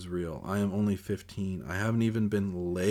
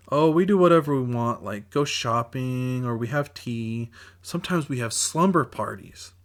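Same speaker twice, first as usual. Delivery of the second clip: put-on high, bubbly child voice; little girl voice; 4 semitones higher, 5 dB louder than usual. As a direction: chirp like a girl playing dolls.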